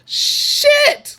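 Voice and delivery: very high sudden exclamation; squealed dismay; shocked outburst. Retold or imitated. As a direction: blurt it in shock. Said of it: "And I was just like".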